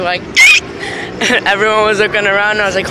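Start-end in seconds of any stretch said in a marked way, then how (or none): 0.2-1.4 squeaky toy noise, very high; high squeak. Shown as a demonstration. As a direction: let out a shrill squeak. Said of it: "the squeaking noise I do".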